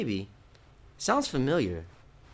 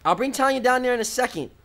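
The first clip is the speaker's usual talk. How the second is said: loud, high holler; raised voice carrying to another room; shouting back; 10 semitones higher, 6 dB louder than usual. Acted. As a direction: tease them loudly down the hall.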